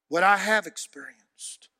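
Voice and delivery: ordinary delivery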